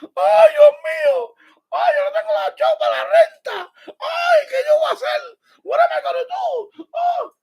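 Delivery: high, loud hammed-up sobbing wail; mother crying about the rent, hammed-up wail; mock hysterical crying. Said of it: "she was hysterical crying".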